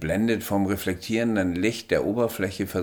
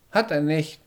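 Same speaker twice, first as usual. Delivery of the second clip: short, higher reply; curt small alien; 10 semitones higher, 4 dB louder than usual. A narrator's reading. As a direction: brush it off briefly as the alien. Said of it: "gab er nur kurz zurück".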